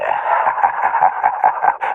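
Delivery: deep breathy cruel chuckle; low menacing laugh. A narrator's reading. Attacker laughs at the broken boy, a cruel low laugh. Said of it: "lachte er".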